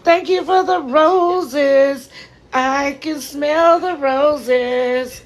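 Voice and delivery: high, slow, crying through the words; real crying over a gift of roses; tearful thanks. Real, not acted. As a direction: let the tears carry each word. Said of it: "Let it out, Queen".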